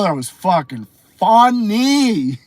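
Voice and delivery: ordinary delivery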